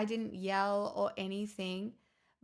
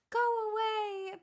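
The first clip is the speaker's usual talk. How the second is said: put-on much higher, slow whiny child voice; mock toddler tantrum; 12 semitones higher, 3 dB louder than usual. Imitating her niece refusing pajamas, high whiny tantrum. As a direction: whine it like a tantruming little girl. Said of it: "she was like".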